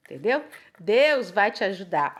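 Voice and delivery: ordinary talk, nothing marked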